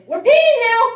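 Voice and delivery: high, bright announcement; cheery wake-up call. Retold or imitated. Waking the sleeping boyfriend, high cheery announcement. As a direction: chirp it like good news.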